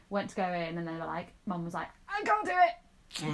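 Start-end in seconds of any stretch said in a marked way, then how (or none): 2.1-3.2 higher, slow, panicky mock of a scared mum. Retelling mum panicking in the dentist chair, high and scared. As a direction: squeak it out like a frightened patient.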